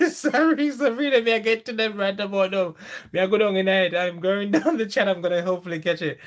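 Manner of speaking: laughing it off while catching up on chat. Real, not acted. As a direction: talk while still laughing.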